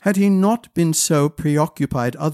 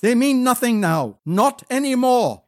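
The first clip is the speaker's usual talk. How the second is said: bitter anger; 8 semitones higher than usual. Acted. Niles rejecting, raised voice.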